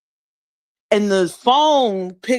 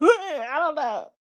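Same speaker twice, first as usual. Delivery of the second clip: laughing it off; 6 semitones higher, 4 dB quieter than usual. Real, not acted. Laughing off embarrassing confession.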